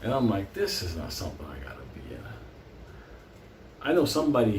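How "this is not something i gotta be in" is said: inner thought. Retold or imitated. Inner thought among bikers.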